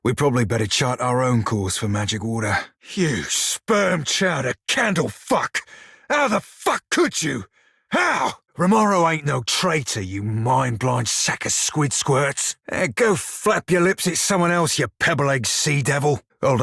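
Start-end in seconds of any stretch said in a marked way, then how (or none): 2.9-8.4 high, slow outraged yelling at the rival; furious pirate outburst. Acted. Pirate yelling at the sea queen who insulted his friend, furious. 8.6-16.2 heated retort. Acted. Defending friend, trading insults.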